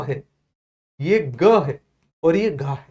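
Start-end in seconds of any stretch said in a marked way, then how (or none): 1.3-1.6 "ग" as g plus neutral a; standard pronunciation. Shown as a demonstration. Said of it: "इनको बोलते हैं साधारण भाषा में". 2.5-2.6 "घ" as breathy-released g plus neutral a; standard pronunciation. Shown as a demonstration. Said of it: "इन्हें साधारण भाषा में उच्चारित करते हैं".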